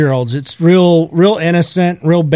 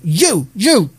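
higher imitated yell; single out the offender, pitch raised; mock scolding; 4 semitones higher than usual. Old lady yelling at second dog, mock scolding. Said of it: "she yelled at him".

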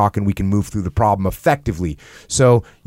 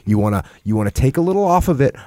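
ordinary delivery

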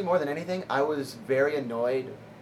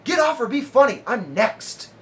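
much higher, loud "Get off or be funny"; imitated heckler shout; 12 semitones higher, 10 dB louder than usual. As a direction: yell it as a rude heckler. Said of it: "He says".

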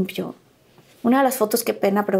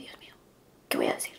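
whispered, panicked to herself; whispered inner panic; 13 dB quieter, faster than usual. Retold or imitated. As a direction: hush it like a secret panic. Panicking inwardly at the door.

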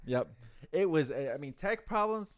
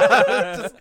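very high, loud laughing shriek; 14 dB louder than usual. Real, not acted. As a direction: burst into a shrill laugh. Laughing at locking coworker out.

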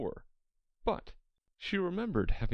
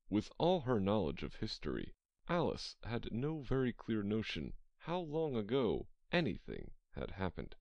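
usual speaking voice

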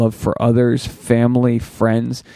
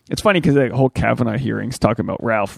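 ordinary delivery

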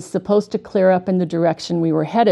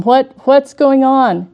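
raised, higher-pitched, drawn-out cry; retold yell; 6 semitones higher, 5 dB louder, slower than usual. Twelve-year-old in the back seat yells to mom, retold yell. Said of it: "I yelled at my mom".

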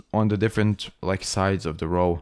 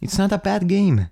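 emphatic protest; 9 semitones higher, 3 dB louder than usual. Real, not acted. Friend insisting to friend that the game isn't bad, high and emphatic. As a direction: pitch jumps way up, insisting it's good.

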